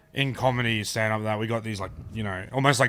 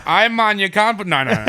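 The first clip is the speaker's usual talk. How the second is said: laughing refusal; 9 semitones higher, 7 dB louder than usual. Real, not acted. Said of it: "I won't do the accent".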